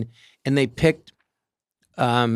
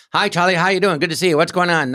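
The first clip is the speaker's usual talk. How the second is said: put-on old movie fast talk; 4 semitones higher than usual. Mocking old movies to his mom, rapid old movie patter. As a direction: rattle it off like a forties film. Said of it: "Why do they talk like that so fast".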